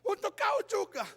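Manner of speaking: acted tearful protest, rising to a wail; crying wife. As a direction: sob between phrases as the hurt wife. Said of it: "istrinya langsung menangis".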